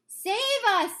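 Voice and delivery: high, loud scream for help. Acted. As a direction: scream in terror. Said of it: "screamed the flesh lump eater".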